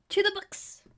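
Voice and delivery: high, exclaimed call to action; eager rallying cry. Acted. Witch leads customer off to research, rallying cry. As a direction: launch the search with gusto.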